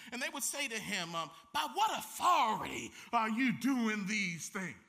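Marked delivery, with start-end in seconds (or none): 1.5-4.8 loud, affronted challenge; a mock-outraged yell of the priests; indignant shout. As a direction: shout it at him, offended. Leaders challenge Jesus again.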